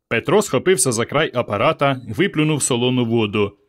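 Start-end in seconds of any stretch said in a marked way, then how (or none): none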